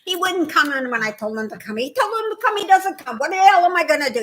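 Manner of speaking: put-on een overdreven New Yorks accent, hoog en fel. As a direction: doe een opgewonden New Yorkse vrouw na. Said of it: "I was talking like this".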